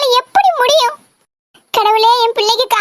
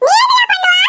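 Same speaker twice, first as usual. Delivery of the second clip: laughing shout; 9 semitones higher than usual. Acted. Sister yells back at her brother through laughter.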